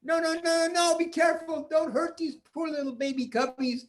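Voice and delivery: put-on high, urgent young boy; pleading boy. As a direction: beg the bear to stop. Peter pleading.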